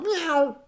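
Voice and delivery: a high, playful cat meow; high mock meow. Cat chased by the dog, a high meow. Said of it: "a cat runs by".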